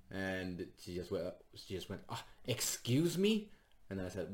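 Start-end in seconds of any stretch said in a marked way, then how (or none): 2.1-3.5 offended. Retold or imitated. Drunk heckler offended reply.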